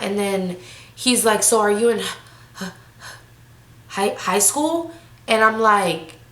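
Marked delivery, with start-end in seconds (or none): 1.4-4.9 halting stammer with long gaps; imitated hesitation. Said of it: "are you hesitating?".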